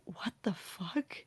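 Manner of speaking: barely voiced, mouthing the words; near-silent confused mouthing; mouthed. A narrator's reading.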